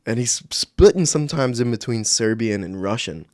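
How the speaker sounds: usual speaking voice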